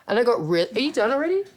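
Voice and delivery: ordinary delivery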